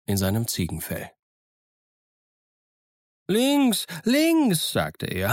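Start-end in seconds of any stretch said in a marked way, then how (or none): 3.3-4.7 higher, lively voice of a half-wild marooned sailor; higher-pitched, eager old castaway; eager castaway Ben Gunn. Acted. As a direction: play the odd, chattering island hermit, pleased with himself. Ben Gunn guiding while running.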